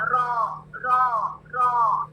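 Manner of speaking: रो held long and rounded; drawn-out ro. Shown as a demonstration. Teacher isolates the ro sound for students. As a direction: stretch each रो slowly. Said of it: "आरे W रो वाली sound".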